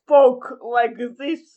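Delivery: slow mock Russian accent; tentative try at a Russian accent; attempted Russian accent. Shown as a demonstration. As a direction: drag it out in a movie-Russian accent. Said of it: "can't even do this accent".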